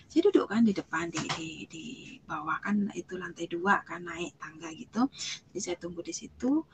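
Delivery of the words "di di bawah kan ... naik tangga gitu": ordinary talk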